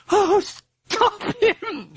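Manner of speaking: gasping, high-pitched weeping plea. A narrator's reading. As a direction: wail it slowly, short of breath. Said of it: "she wept".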